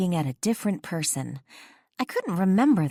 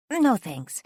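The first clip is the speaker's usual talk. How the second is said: quick refusal; 8 semitones higher, 3 dB louder than usual. A narrator's reading. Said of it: "she instantly replied".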